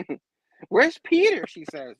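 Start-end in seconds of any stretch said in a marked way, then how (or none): 0.7-1.4 high, loud mock-frantic question; hammed-up anxious shriek; worried cry. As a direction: call out in put-on panic. Acting May asking for Peter.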